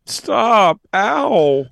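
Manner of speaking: high loud yelp; yelling in pain. Acted. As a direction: yell as if being struck. Acting being hit.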